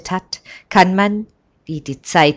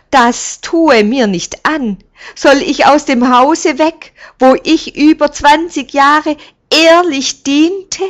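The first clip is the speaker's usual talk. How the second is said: distressed plea; 10 semitones higher, 9 dB louder than usual. A narrator's reading. Servant pleads not to be sent away.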